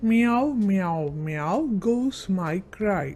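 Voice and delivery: slow sing-song, word by word; teacher reads the cat verse aloud slowly; slow recitation. Shown as a demonstration. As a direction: recite it slowly so they can write it. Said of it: "എഴുതി നോക്കാം".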